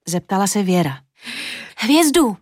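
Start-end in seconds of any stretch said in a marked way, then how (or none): 1.8-2.4 high-pitched, eager exclamation; child's high excited outburst; excited cry. A narrator's reading. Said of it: "vykřikla Julinka".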